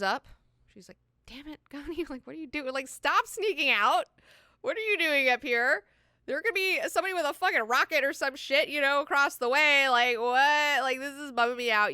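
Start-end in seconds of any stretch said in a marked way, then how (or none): 1.3-11.9 raised, high-pitched mock telling-off; exasperated scolding. Irulan catches Ghani sneaking out, exasperated scolding. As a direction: scold a kid caught sneaking out.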